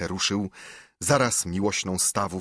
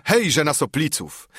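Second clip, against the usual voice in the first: raised, higher rallying call; battle cry; 6 semitones higher, 3 dB louder than usual. A narrator's reading. Gentry storming the manor, battle cry. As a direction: call out the charge.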